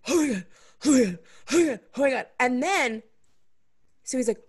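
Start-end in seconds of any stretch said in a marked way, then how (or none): 0.1-2.3 fast, breathless Oh my God; breathless gushing. Retold or imitated. Guy gasping after sex.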